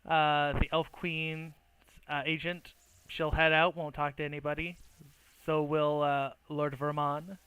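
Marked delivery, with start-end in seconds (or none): none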